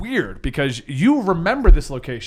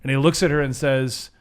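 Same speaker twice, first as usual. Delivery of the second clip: usual speaking voice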